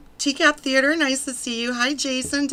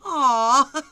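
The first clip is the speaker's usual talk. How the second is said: loud aww; 4 semitones higher, 10 dB louder than usual. Real, not acted. Sympathetic reaction.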